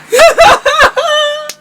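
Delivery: a squealing high-pitched giggle; high laugh. Real, not acted. Signing off to fans with a squealing high laugh. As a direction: let out a high little laugh. Laugh after blowing a kiss.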